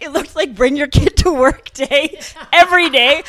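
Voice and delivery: a real laugh breaking into the line. Real, not acted. Laughing about own youthful look.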